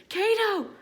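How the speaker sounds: high-pitched scream for help; screamed name. A narrator's reading. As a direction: cry out the name sharply upward, desperate. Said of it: "she screams".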